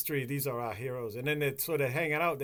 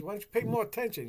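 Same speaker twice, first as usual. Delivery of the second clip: a sharp, higher retort; husband snapping back; 8 semitones higher, faster than usual. Retold or imitated. Husband snaps back at his wife, higher and sharper. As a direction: throw the question back at her.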